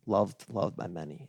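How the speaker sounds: hushed, solemn elder paying respects; old Italian man. Retold or imitated. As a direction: impersonate an old man's grave, near-whispered eulogy. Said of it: "this old Italian man came up to me".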